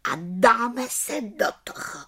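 high, satisfied little witch; contented exclamation. A narrator's reading. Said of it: "spokojeně zvolala malá čarodějnice". (spokojeně, vysokým hlasem)

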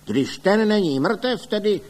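exasperated high outcry; indignant shouting. A narrator's reading. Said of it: "křičel pan doktor Link".

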